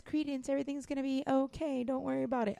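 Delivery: quiet, gentle mock reassurance; lightly voiced soothing character; soft mock comfort. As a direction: mimic a soothing whisper-soft line. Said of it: "those three lines is".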